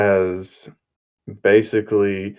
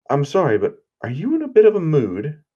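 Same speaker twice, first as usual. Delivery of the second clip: mock-delicate question; 6 semitones higher, faster than usual. Deku questions Cammy's bad mood.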